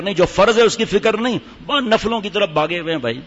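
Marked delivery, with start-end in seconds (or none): none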